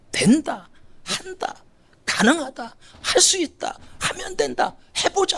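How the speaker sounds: high, eager chant of can-do phrases; upbeat affirmations. Retold or imitated. Preacher models positive self-talk for the congregation, upbeat and high. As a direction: pump yourself up with each phrase. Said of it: "긍정의 언어를 자꾸만".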